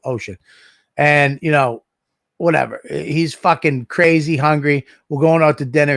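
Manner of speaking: ordinary talk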